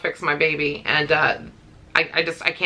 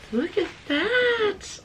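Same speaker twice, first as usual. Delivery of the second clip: much higher, slow, soft coo; 12 semitones higher, 5 dB quieter, much slower than usual. Real, not acted. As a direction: croon it again up high. Admiring gift again.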